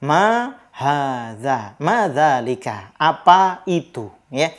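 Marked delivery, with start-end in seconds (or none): 0.0-1.8 slow demo. Shown as a demonstration. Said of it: "diwakili dengan kalimat". 1.8-2.9 slow demo. Shown as a demonstration. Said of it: "diwakili dengan kalimat".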